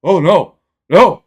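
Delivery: loud, high "oh no"; a hammed-up cry of dismay; mock horrified. Hearing dad say it, mock horrified loud cry. Reacting to dad's slow blurt.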